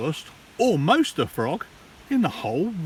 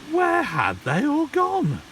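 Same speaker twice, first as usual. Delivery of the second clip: worried; 11 semitones higher than usual. A narrator's reading. Worried question of Tad.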